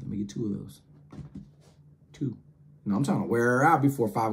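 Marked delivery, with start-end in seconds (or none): 0.0-2.4 hushed repeat. Retold or imitated. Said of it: "I said".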